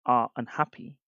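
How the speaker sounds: usual speaking voice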